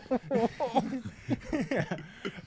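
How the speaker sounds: loud, high burst of laughter; long real cackle; big laugh. Real, not acted. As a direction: crack up at your own crude joke. Cracks up at own crude joke.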